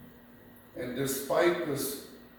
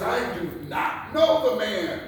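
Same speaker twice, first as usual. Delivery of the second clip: higher, louder, forceful denial; vehement denial; 8 semitones higher, 5 dB louder than usual. Acted. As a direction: Peter insists, raising his voice. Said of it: "denied it with an oath".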